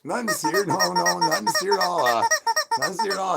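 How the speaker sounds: high squeaky mock-innocent voice. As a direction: pretend nothing happened, way up high. Joking denial, put-on voice.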